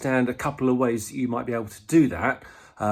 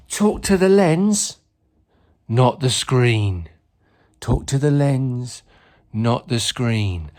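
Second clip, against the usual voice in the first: slow, loud, each word weighted; slow emphatic instruction; 9 dB louder, slower than usual. Acted. [name] hammering home the last top tip, slow emphatic delivery. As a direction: drill "talk to the lens, not the screen" slowly.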